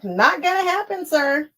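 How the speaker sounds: high, sassy brush-off to her husband; mock refusal. Telling her husband off about the quilt, sassy and high. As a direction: turn him down with a cheeky high lilt. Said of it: "And I'm like".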